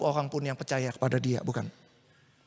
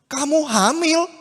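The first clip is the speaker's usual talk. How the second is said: higher, louder shocked outburst; shocked cry; 8 semitones higher, 6 dB louder than usual. Acted. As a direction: blurt it out in disbelief. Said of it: "teriak gitu kan Yusuf". (Yusuf kaget mendengar Maria hamil, berseru)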